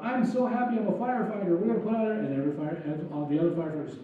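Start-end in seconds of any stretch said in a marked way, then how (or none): 0.0-2.1 high, quick, gushing rookie; eager new kid. Retold or imitated. New firefighter on the job, imitated as eager. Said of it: "Or the new kid on the job".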